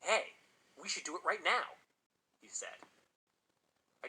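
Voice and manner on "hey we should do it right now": higher-pitched eager suggestion; narrated boy's excitement; excited. A narrator's reading. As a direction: pitch it up, eager to do it right now. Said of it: "a gleam of excitement in his eyes".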